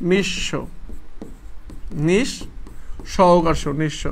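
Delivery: "নিঃস্ব" in separated parts, then whole; pronunciation demo. Shown as a demonstration. Teacher showing students how "নিঃস্ব" is pronounced, demonstrating part by part. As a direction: break "নিঃস্ব" after "নিঃ", pausing between parts. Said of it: "উচ্চারণটা হবে এভাবে".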